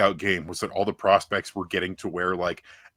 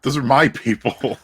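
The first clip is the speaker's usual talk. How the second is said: loud, higher mock repeat of the claim; mocking echo; 6 semitones higher, 8 dB louder than usual. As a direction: throw his words back at him, teasing.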